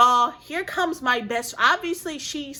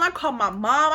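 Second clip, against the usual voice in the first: plain reading voice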